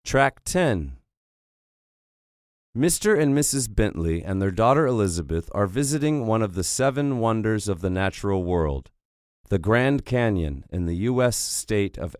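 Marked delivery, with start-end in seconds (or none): none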